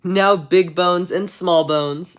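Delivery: ordinary delivery, nothing marked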